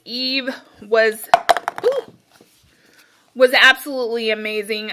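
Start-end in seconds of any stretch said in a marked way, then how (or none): 1.8-2.1 sudden high ooh of delight. Real, not acted. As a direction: swoon over the memory of the scent. Recalling a loved scent.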